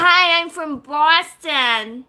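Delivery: put-on slow, drawn-out Boston accent. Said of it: "You wanna talk like you're from Boston?".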